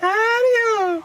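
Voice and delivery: a tiny cartoon poo says hello; Mr Hanky. Host echoing the cartoon greeting, squeaky and high. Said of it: "Mister Hanky the Christmas poo".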